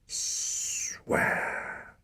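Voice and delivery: a voiceless hiss of a command. A narrator's reading. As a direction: hiss the single word. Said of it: "hissed his father".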